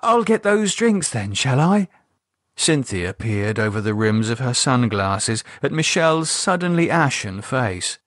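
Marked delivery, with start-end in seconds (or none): 0.0-1.9 much higher, forced bright cheer; cheerier tone. Acted. Michelle covering her shock with forced cheer for the couple. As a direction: cover shock with a breezy offer. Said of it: "continued in a cheerier tone".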